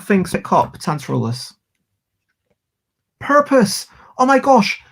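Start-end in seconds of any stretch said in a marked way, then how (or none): 3.2-4.8 high, loud burst of delight; excited exclamation. Real, not acted. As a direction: yelp with joy at the lucky draw. Finds the word she needed.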